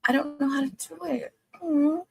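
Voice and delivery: high, whimpering complaint; whiny frustration. Real, not acted. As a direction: whine it out, close to tears. Frustrated, can't work the poll.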